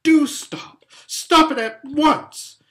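loud, high, scolding shout; bawling. A narrator's reading. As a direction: order him to stop at full voice. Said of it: "bawled Lucy in his ear".

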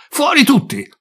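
loud, high urgent shout; shouted order. Acted. Firefighter shouting at his crew to get out, loud urgent yell. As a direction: yell it so everyone clears out.

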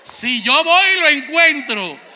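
loud, laughing threat from a fed-up mom; mom's shouted threat. Retold or imitated. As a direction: warn him loudly, half laughing. Madre amenaza a gritos. (amenaza de madre a gritos)

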